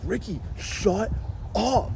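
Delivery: drawn-out, high-pitched scolding; mock-exasperated passengers, voice swooping up. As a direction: stretch each word to shut a friend up. Passengers scold Ricky in unison.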